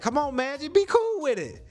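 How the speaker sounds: high, excited coaxing; urging friend. Retold or imitated. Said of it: "I'm like".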